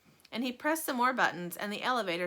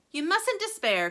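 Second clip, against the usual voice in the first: cried out; 9 semitones higher, 5 dB louder than usual. A narrator's reading. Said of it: "cried Mister Wonka".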